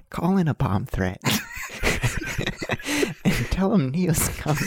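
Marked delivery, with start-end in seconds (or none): 1.3-3.2 high, giggling burst of laughter. Real, not acted. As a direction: crack up at your own joke. Laughs at his own bomb joke.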